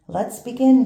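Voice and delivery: loud call over a noisy room. Acted. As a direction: raise the voice to be heard. Librarian calls over noise.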